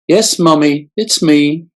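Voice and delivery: put-on high, whimpering childlike falsetto. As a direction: whimper it like a small scared boy. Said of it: "a childlike falsetto whimpered".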